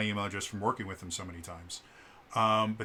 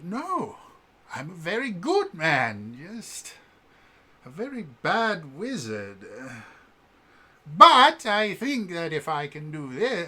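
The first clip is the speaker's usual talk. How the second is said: wizard voice pitched higher and slower; high wizard character voice; 6 semitones higher, 6 dB louder, slower than usual. Shown as a demonstration. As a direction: lift the voice up into a showy wizard. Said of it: "I used kind of a character voice. I moved my voice up here".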